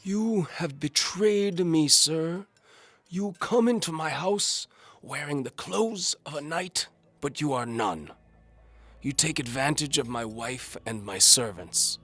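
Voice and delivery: high, slow, loud quavering lord; betrayed old Duke. A narrator's reading. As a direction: accuse him with wounded pride. Duke's wounded accusation.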